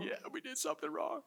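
put-on sheepish kid answering dad; meek little boy. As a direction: squeak it like a guilty child.

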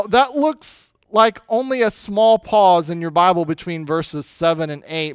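usual speaking voice

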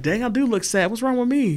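mock surprise at yourself. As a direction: realise it in the mirror with a jolt. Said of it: "you're like".

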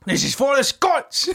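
rally them in mock Scottish; Scottish accent. Host rallying for the Scots, comic Scottish accent. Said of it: "I think it's Scotland".

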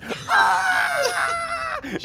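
shrill high scream of terror; girl's scream. Acted. GM voicing the girl screaming at the stranger. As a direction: scream like a terrified child. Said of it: "She screams".

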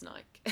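Nike with no final ee; "Nike" rhyming with bike; one-syllable Nike. Shown as a demonstration. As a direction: clip the second syllable off Nike. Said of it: "don't have time for a, a second syllable".

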